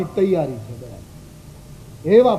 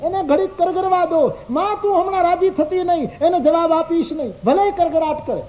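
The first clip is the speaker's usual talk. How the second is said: much higher, urgent devotee's plea; fervent pleading; 12 semitones higher than usual. Acted. Devotee urging Radha to let Krishna keep begging, fervent and high. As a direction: beg her with rising passion not to give in yet.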